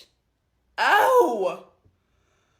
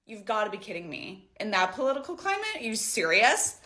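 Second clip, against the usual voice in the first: lower, softer, scornful disbelief; condescending woman; 4 semitones lower, 6 dB quieter than usual. Retold or imitated. The older woman scoffs at the narrator, low and scornful. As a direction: sneer at her naive question. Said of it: "She goes".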